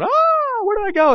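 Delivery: high, panicky squeak of a freaked-out preacher; panicked. Retold or imitated. Pastor called in sick, sudden panic. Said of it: "I'm, like, freaking out".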